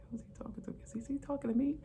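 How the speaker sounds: puzzled whisper, mostly voiceless, rising on me; whispered thought. Retold or imitated. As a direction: whisper it to yourself, unsure. Puzzled inner thought at desk.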